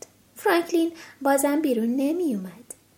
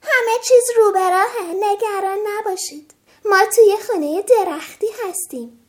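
loud, higher reassuring shout; shouting back; 6 semitones higher, 7 dB louder than usual. Acted. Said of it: "روباه داد زد".

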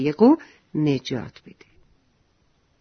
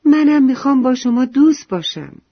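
high, raised storybook shout; loud cry; 8 semitones higher, 7 dB louder than usual. A narrator's reading. Poppy calls out asking to be everyone's friend, loud cry. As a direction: call it out loud and high to the whole group. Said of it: "بلند فریاد زد".

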